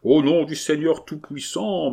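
ordinary delivery, nothing marked

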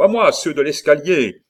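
high, loud summons; urgent rallying shout. A narrator's reading. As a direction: yell to men on the stairs. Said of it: "cria Montsoreau".

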